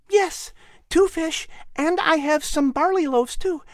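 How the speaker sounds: high, happy voiced child; little boy voice. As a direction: play an eager little boy offering his lunch. Little boy answers happily.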